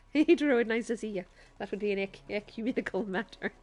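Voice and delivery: loud, hammed-up Irish accent; Father Ted Irish. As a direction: call it out like an Irish sitcom priest. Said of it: "sound a bit like I'm from Father Ted".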